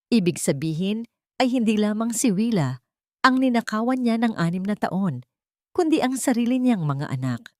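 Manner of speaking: ordinary delivery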